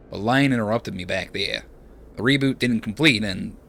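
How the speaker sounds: put-on light Australian accent, casual; lightly voiced Australian bloke; Aussie accent. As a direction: give him an easy Aussie twang. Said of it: "decided that Seraphin is Aussie".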